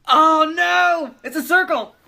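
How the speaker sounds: high, loud cry of alarm; mock dismay. Co-narrator reacting to mallard falling into gopher hole, mock dismay. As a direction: wail it out in hammed-up horror.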